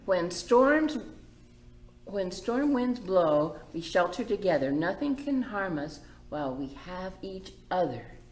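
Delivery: bright, lilting story read; gentle read-aloud for the little ones; reading to children. A narrator's reading. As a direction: read it like a picture book to kids. Reads picture book aloud.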